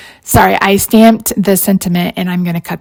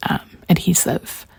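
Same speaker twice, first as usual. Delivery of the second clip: unvoiced trailing whisper; 2 semitones lower, 8 dB quieter than usual. Real, not acted. Crafter peeling backer sheets, trailing whisper. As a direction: drop to a whisper.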